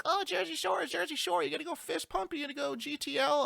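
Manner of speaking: mock the tourists who love the show; New Jersey local mocking Jersey Shore tourists; mocking Jersey Shore fan. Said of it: "everyone's like".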